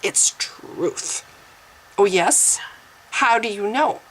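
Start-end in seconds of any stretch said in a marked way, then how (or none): 0.0-1.2 "truth" low and hushed, as if sacred; mock-reverent weight on "truth", voice dropped; truth, capital T. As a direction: intone "truth" softly like a capitalised word. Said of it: "you call it truth with a capital T".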